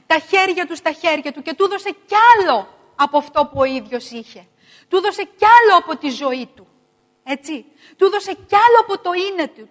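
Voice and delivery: loud, high, ringing preacher's cry; loud repeated preaching build; fervent preaching. Acted. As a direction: preach it out with rising fire. Preaching at full force.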